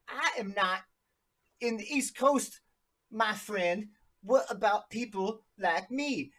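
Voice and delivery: high, slow mock Canadian accent; Canadian-ish accent. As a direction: play up a Canadian lilt to tease a friend. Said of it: "almost like Canadian".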